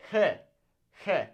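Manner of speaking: "He" as heh with throat friction; throaty sound. Shown as a demonstration. Teacher models Spanish G, throaty sound. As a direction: rasp the h of "He" in the throat. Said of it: "Kinda a throat, throaty kinda sound".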